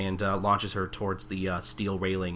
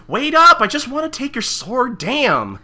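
much higher, louder whiny yell; mock shout; 11 dB louder than usual. Friend parodying the swordsman, high whiny shout. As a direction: yell after them, whining.

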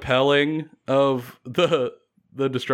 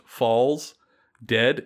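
ordinary delivery

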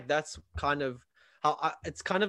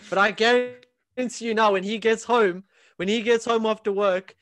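raised, pressing voice; almost shouting the point; emphatic loud; 8 semitones higher, 8 dB louder than usual. Real, not acted. Heated rant about a fitness influencer.

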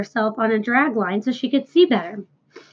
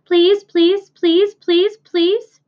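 high, louder chorus of pleading; teasing goslings; 7 semitones higher, 5 dB louder, much slower than usual. Acted. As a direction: nag like a flock of little goslings. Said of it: "all seven began teasing to go".

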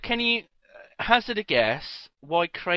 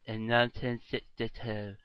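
words mangled by a lolling tongue; tongue hanging out; 6 semitones lower, 8 dB quieter than usual. Pretend. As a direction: talk with your tongue out of your mouth. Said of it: "say it with your tongue hanging out".

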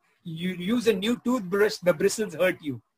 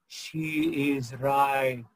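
low, very slow divine voice; voice from heaven; 5 semitones lower, much slower than usual. Retold or imitated. God answering from heaven, low and slow. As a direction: boom it down slowly from above. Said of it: "there was a voice from heaven".

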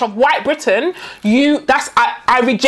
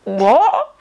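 ordinary talk, nothing marked

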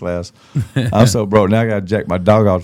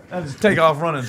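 ordinary delivery

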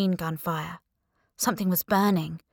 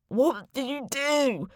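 slow, high, wavering dead man's voice; a dead man accusing the narrator, slow and warbling; ghostly warble; 7 semitones higher, slower than usual. A narrator's reading. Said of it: "he warbled at me".

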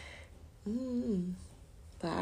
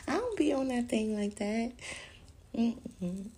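normal talking voice

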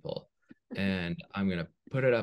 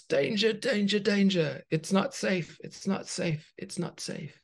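alarm-barking guard; 12 semitones higher than usual. Acted. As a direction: sound the alarm, then run out of steam. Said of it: "barking instructions".

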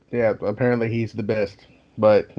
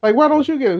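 much higher, louder, squeaky protest; exasperated protest; 13 semitones higher, 4 dB louder than usual. Retold or imitated. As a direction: push the job back in a panicked pitch. Protesting being sent after thief.

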